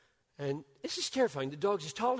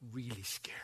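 breathy whisper, much softer; 3 semitones lower, 7 dB quieter than usual. Acted. Preacher warning the congregation in a whisper. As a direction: hush it as a warning. Hushed aside.

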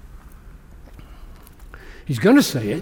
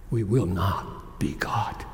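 whisper; 8 semitones lower, 6 dB quieter than usual. Real, not acted. Solemn hushed contrast line.

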